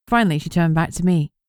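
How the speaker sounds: plain reading voice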